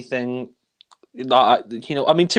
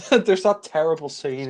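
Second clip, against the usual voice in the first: ordinary talk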